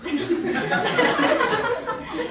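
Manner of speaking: loud, high burst of laughing; burst of laughter. Real, not acted. Student cracking up while telling the testimony, loud laughter. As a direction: crack up loudly.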